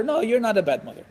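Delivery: ordinary talk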